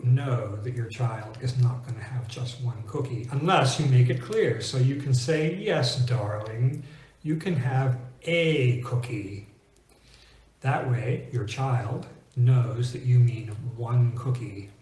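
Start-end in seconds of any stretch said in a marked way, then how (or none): 5.5-9.5 "a" stressed and said as long "ay"; drawn-out parent's reply with emphatic "a" like the letter A. Acted. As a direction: slowly, hit "a" as "ay" to mean only one. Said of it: "because now we're emphasizing".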